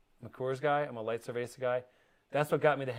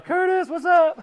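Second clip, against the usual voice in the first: high, loud excited greeting; high-pitched shout; 11 dB louder, slower than usual. Retold or imitated. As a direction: squeal the greeting. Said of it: "He's making me like".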